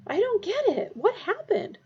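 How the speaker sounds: put-on high, confused boy; shaken boy voice. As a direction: ask in a daze. Character voice, shaken.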